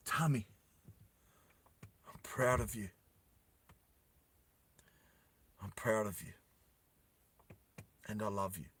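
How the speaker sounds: a dying man pushing out his last words; weak, dying father. Acted. Wounded father tells his son he is proud, faint.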